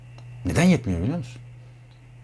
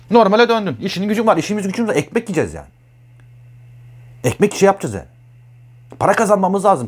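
higher and louder, pressing; emphatic loud rant; 7 semitones higher, 4 dB louder, faster than usual. Real, not acted. Telling the listener life goes back to normal, loud and insistent. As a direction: hammer home the daily grind. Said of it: "back to reality rant".

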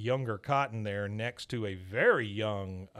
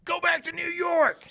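much higher, louder hammed-up shout; mock yell from a truck; 7 dB louder than usual. Passing trucker yelling at Hank, high and loud. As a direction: yell it out the window while passing.

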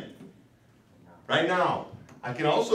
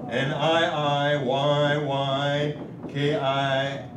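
letters spelled slowly in a sing-song; much slower than usual. Shown as a demonstration. Teacher spelling the lengthened word for the class, slow and sing-song. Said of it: "I can lengthen that first vowel".

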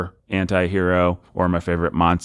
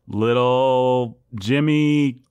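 ordinary talk

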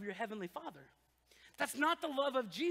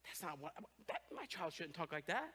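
disapproving God; 8 semitones lower, 11 dB quieter than usual. Retold or imitated.

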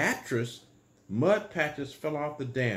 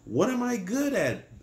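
higher-pitched wondering question; wondering beaver; 9 semitones higher, 4 dB louder than usual. A narrator's reading. Young beaver Beatrice asking herself what she is good at, wondering. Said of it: "Beatrice wondered".

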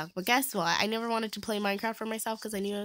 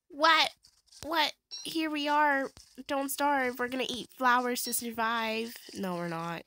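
normal talking voice

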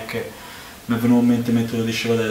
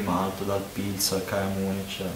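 glass held at lips; muffled by glass rim; speaking into a glass; 3 semitones lower, 9 dB quieter than usual. Real, not acted. Seen 1: Unclear which man speaks; right man's glass reaches mouth later.

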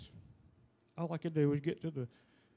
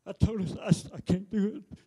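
emotional outburst; 4 semitones higher, 7 dB louder than usual. Real, not acted. Husband recalls wife on ventilator.

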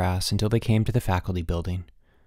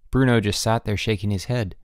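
plain reading voice

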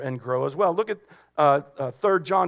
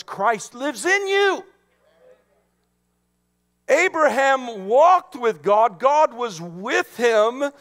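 high, ringing proclamation; full-force preaching; 6 semitones higher than usual. Real, not acted. Preacher rousing congregation to praise God, high and fervent. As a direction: declare it with mounting fervor, driving each phrase.

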